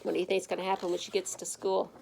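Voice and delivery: ordinary delivery, nothing marked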